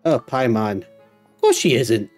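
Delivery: ordinary delivery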